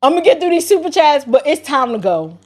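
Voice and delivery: usual speaking voice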